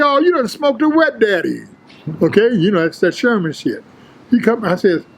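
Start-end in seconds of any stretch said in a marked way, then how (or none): 0.0-1.6 higher, ribbing jeer; mocking tease. Teasing gym rival back glassy-eyed, mocking jeer. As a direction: needle him for coming back high.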